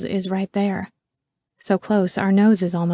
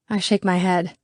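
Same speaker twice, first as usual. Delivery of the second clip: usual speaking voice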